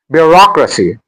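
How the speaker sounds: "Bureaucracy" with ROC made prominent; stress on second syllable. Shown as a demonstration. Teacher modelling word stress, "Bureaucracy" stressed bu-ROC-racy.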